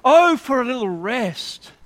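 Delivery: loud, high-starting plea, falling away; acted cry of longing for relief. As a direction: cry out as if pushed to the brink. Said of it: "and you cry out".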